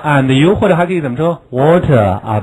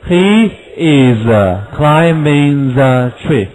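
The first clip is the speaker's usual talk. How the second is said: slow, he is kept apart; full he is, unhurried; slow full form; much slower than usual. Shown as a demonstration. Teacher gives the full form before he's. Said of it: "留了一点".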